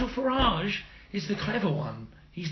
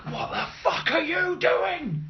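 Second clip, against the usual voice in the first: mock outrage; 13 semitones higher, slower than usual. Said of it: "being like".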